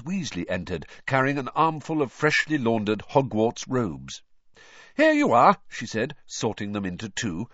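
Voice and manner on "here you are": motherly voice. A narrator's reading. Mother handing over robes, high motherly voice. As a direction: voice her as a bustling mother.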